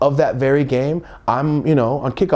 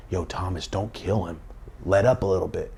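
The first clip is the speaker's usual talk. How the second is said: soft self-talk; 4 semitones lower, 7 dB quieter than usual. Retold or imitated. Talking himself down.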